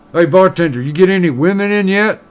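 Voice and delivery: lightly voiced pushy miner; miner in a joke.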